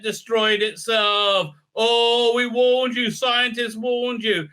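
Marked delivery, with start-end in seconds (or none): 1.7-4.5 wag the finger in a hammed-up voice; mock scolding. Lecturing hero scolding humanity, mock preachy whine. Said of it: "mocking the preachy warning".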